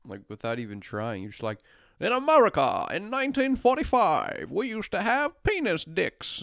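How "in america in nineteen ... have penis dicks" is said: much higher, hammed-up 1940s newsreel voice; old-timey announcer. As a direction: parody a stiff old narrator. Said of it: "Why do you speak like that?".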